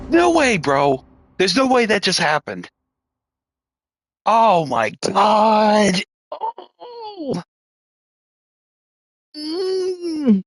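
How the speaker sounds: high, strained awe, half-laughing; can't believe what just happened; stunned disbelief. Real, not acted. Gamer marveling at what just happened on screen, high and stunned. Stunned reaction to game moment.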